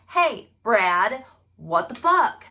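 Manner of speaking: hammed-up confronting the suspect; mock confronting.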